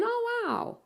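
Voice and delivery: high, airy oh wow of surprise; surprised. Real, not acted. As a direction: caught off guard by a comment. Surprised reading chat.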